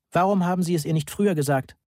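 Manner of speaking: an understated indignant call. A narrator's reading. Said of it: "rief ich von meinem Platz".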